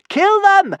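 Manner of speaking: very high, loud shriek. A narrator's reading. Kamikaze shrieks at the serpents, very high and loud. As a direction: shriek it in fury. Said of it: "shrieked Kamikaze".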